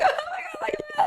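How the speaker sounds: high, drawn-out tearful squeal, half laughing; mock-crying, soft high gush; overwhelmed with emotion. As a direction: imitate being overcome at a proposal. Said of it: "Sometimes with the emotion".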